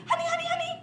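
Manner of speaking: very high, quick honey-honey cries; very high scared cub voice; panicky little bear. Shown as a demonstration. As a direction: squeak it out like a stuck cub. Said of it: "Can you all say".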